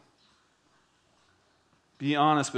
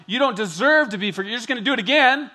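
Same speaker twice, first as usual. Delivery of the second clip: louder, higher mimicked retort; mock accusing reply; 5 semitones higher, 5 dB louder, faster than usual. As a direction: imitate someone refusing to forgive.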